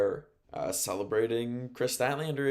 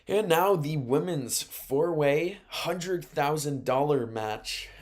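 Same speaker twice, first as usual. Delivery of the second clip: slow, higher, louder announcer read; mock big-event announcement; ring announcer intro; 5 semitones higher, 3 dB louder, slower than usual. Announcing the next match.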